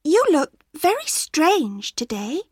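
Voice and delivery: lightly voiced small girl; girl's voice. A narrator's reading. Girl noticing grandma looks strange, lightly high. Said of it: "suddenly she was afraid".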